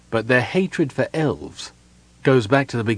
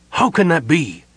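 raised, mildly angry question; angry demand; 6 semitones higher, 4 dB louder than usual. A narrator's reading. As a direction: demand it, incredulous. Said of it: "Samar demanded angrily".

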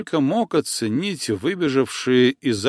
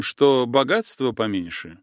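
usual speaking voice

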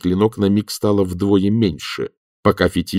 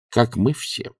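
ordinary delivery, nothing marked

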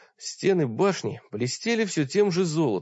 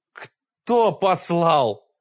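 very slow, higher, much louder menacing wail; terrible yell; 5 semitones higher, 5 dB louder, much slower than usual. Acted. Furious old wizard yelling at the intruders, dreadful slow wail. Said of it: "завопил старик страшным голосом".

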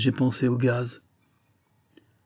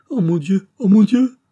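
rising to a high, loud panicked scream; panicked cry; 10 semitones higher, 8 dB louder than usual. A narrator's reading. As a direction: horror breaking out. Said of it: "affolée".

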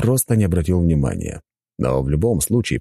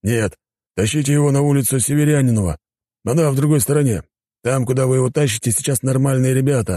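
higher-pitched character voice, practical; policeman, instructing; 9 semitones higher than usual. Acted. Policeman redirects homeless men elsewhere, higher instructing voice. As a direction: give directions, pitched up.

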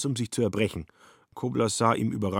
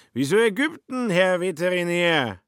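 put-on drunk Schmitz; 10 semitones higher, 5 dB louder than usual. Drunk Schmitz questions Kobler.

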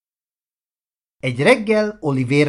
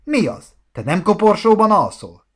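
high-pitched taunting question; mocking; 8 semitones higher, 4 dB louder than usual. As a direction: needle a friend with mock surprise. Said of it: "kérdezte gúnyosan". (gúnyos, magas hangú kérdés)

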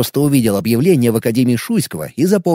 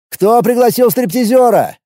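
mocking shout; 8 semitones higher than usual. Taunting to provoke a fight.

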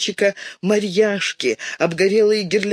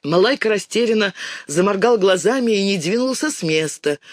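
usual speaking voice

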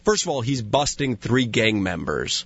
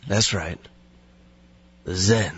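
low, lazy deadpan sage; terse and self-serious, in character; Steven Seagal impression; 5 semitones lower than usual. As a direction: confirm it solemnly, pitched low. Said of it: "key portion of the impersonation".

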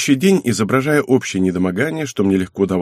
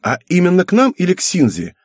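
put-on high, eager girl's question; excited daughter checking details; enthused; 8 semitones higher, 4 dB louder than usual. A narrator's reading. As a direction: ask keenly in a high voice. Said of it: "воодушевилась Мизуки".

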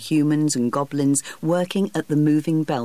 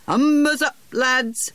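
shouted order; 10 semitones higher, slower than usual. A narrator's reading. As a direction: bellow it out across the water. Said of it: "the coxswain shouted".

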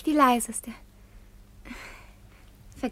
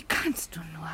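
breathy hushed whisper; 10 semitones lower, 10 dB quieter, slower than usual. Acted. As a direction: lean in and whisper it. Daughter scolds father in a whisper.

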